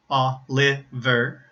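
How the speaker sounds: "Oliver" slowly in three pieces, O, li, ver; split into syllables. Shown as a demonstration. Teacher breaks down the family name, slow "Oliver" syllable by syllable. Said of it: "the second two syllables".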